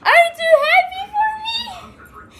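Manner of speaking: very high, breathy teary whisper; squeaky happy whisper. Real, not acted. Overjoyed vlogger over new camera.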